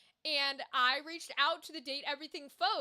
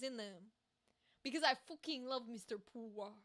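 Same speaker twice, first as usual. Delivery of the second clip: hammed-up, badly faked French accent; playful, clumsy mock-French accent. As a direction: attempt a French accent and miss. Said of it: "That's an accent I can't do".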